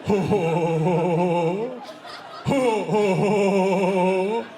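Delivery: put-on low, dopey teen guffaw; loud, deep mock teenage laughter; teenage boy. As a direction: imitate a room of dim boys hooting. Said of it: "every teenage boy sounds like that".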